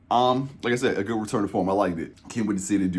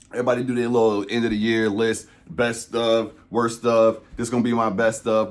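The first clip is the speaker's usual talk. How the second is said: usual speaking voice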